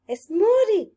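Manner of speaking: high excited shout. A narrator's reading. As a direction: shout it out in surprise. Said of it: "shouted Japhet".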